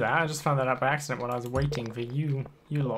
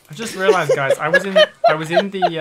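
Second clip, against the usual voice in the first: loud, high, laughing confession; laughing while talking; 6 semitones higher, 7 dB louder than usual. Real, not acted. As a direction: fight laughter to get it out. Laughing at own game mistake.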